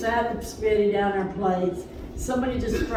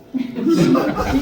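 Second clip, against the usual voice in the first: burst of laughter; 5 semitones higher, 7 dB louder than usual. Real, not acted. Laughs before "terrible story.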